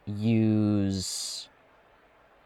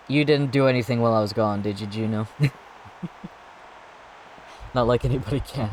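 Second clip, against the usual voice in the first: ordinary delivery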